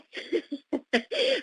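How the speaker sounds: soft, high chuckle; small self-conscious laugh; real laugh. Real, not acted. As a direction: laugh quietly at your own answer. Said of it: "I guess I shouldn't be laughing".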